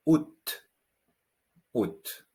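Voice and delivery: août as oo, clipped, final t silent; août with rounded French ou; août, pure oo vowel. Shown as a demonstration. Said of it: "it's another vowel, which is U".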